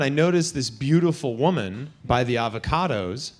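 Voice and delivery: normal talking voice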